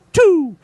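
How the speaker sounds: loud high launching noise; slingshot whoosh. Retold or imitated. To friends telling the creek story, a loud mock launch sound. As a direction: shout the release like a slingshot firing.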